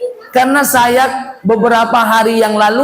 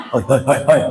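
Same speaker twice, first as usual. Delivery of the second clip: low murmured tone; 8 semitones lower, faster than usual. Shown as a demonstration. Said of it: "lain nadanya". (Hoi, hoi diucapkan rendah dan pelan)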